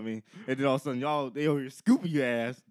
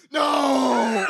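long, high, booming "no"; yelled no; 9 semitones higher, 8 dB louder than usual. Acted. One big person stops the whole group, yelling. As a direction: yell it out in mock horror. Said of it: "the one big person yelled".

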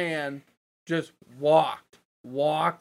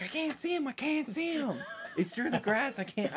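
much higher, hushed and pleading; high strained whisper; 10 semitones higher, 6 dB quieter than usual. Retold or imitated. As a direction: squeak it out in frustration. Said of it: "And I'm like".

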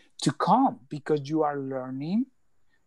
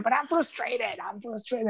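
high, whiny mock frustration; frustrated complaint; 8 semitones higher than usual. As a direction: complain like a frustrated student, pitched up. Retold complaint to spirit guide.

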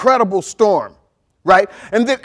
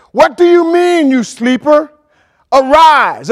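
raised, forceful rebuke to a sleeper; captain's rousing call; 4 semitones higher, 6 dB louder, slower than usual. Acted. Captain coming to the sleeping Jonah, loud rousing rebuke. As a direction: call out loudly to wake him.

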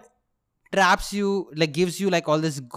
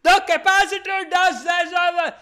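high, loud mock scream; screamed reading; 5 dB louder than usual. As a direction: scream the textbook line, imitating your past self. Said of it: "I literally screamed".

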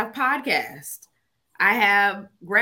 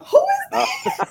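high startled shriek; startled high squeal; 6 dB louder than usual. Retold or imitated. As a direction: squeal it like a sudden fright. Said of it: "I'm just like".

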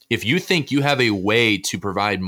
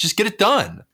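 infomercial voice; 11 semitones higher than usual. Pretend. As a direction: sell it like a late-night ad. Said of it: "just turn on that super infomercial voice".

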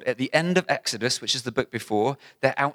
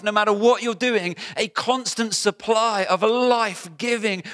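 higher, louder passionate preaching; full-force preaching; 9 semitones higher, 5 dB louder than usual. Real, not acted. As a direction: preach it out loud and high. Preaching climax, loud.

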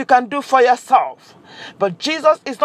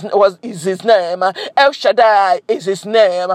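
normal talking voice

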